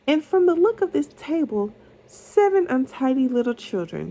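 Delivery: a hint of a princess amused at the mess; light princess voice. A narrator's reading. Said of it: "she said".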